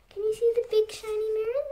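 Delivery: a high, soft aside asking the little listeners; talking to the children. Acted. Reader turns to the children, asks softly. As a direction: lean in and ask the kids gently, high and hushed.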